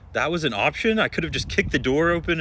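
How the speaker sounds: high, loud and fast incredulous reaction; loud incredulous retort; disbelief. Retold or imitated. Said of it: "I was like".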